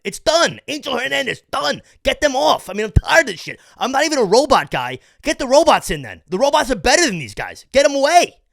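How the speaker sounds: loud, high, exasperated venting; frustrated rant. Real, not acted. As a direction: yell that you're sick of this. Fed-up rant about the umpire.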